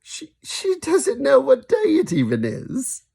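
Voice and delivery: a high, slow, light giggly mum; laughing. Acted. Mum laughing off Granny to her son, high and slow. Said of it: "she laughed".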